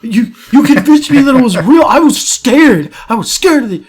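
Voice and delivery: high, loud, indignant rant; hammed-up shaken outrage, high-pitched; mock outrage. Pranked friend cursing out the pranksters, high mock outrage.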